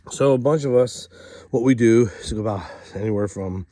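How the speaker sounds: usual speaking voice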